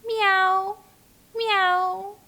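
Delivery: high, rising meow like a kitten; playful kitten meow for children; cat meow. Shown as a demonstration. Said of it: "Figaro, is soft and fluffy".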